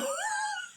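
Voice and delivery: squeaky burst of laughter; high giggle. Real, not acted. Vlogger cracking up at her mock intro, giggling high. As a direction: giggle at her own joke.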